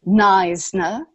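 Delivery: Neisner in an English accent, NIZE-nuh; English-accented Neisner with a long I and a buzzed Z. Shown as a demonstration. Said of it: "again with an English accent".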